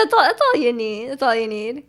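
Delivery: ordinary talk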